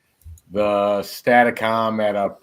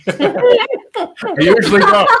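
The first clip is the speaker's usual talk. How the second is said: much higher, slow, through laughter; laughing; 4 dB louder, much slower than usual. Real, not acted. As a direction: laugh right through the words. Joking reply amid laughter.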